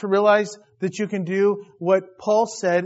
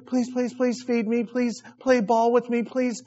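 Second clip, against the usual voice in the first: lightly voiced eager pleading, higher; a touch of whiny begging; begging dog; 6 semitones higher than usual. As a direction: plead like a needy puppy wanting attention. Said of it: "like I'm a dog with my tongue".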